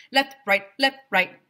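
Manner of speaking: loud barked commands; barking drill. Acted. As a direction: bark the cadence.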